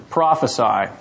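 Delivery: ordinary talk, nothing marked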